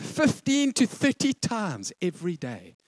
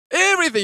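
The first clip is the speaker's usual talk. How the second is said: "Everything." shouted high and loud, falling; preacher to the congregation, hammering home "Everything." loud; stress on everything; 11 semitones higher, 8 dB louder, faster than usual. Shown as a demonstration. "Everything." loudly stressed, dropping at the end. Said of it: "repeats key word emphatically".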